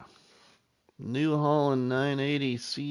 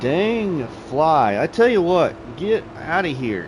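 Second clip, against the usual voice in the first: annoyed; 4 semitones higher, 7 dB louder than usual. Real, not acted. Swatting at a pesky fly.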